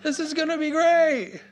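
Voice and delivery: very high-pitched mock delight; hammed-up gullible enthusiasm, loud; naively excited. Preacher voices people lured by the enemy's bait, naively excited. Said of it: "everybody's like".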